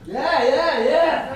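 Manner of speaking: loud, high whoops of yeah; excited shout. Acted. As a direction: shout back to a cheering crowd. Greeting the cheering audience.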